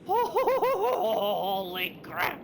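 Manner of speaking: drawn-out laugh of relief; slow laughing exclamation. Real, not acted. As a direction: laugh the words out very slowly. After narrowly escaping death.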